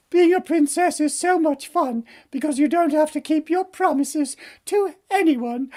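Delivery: high, singsong spoiled princess; smug princess. Acted. Princess gloats over breaking promises, smug princess voice.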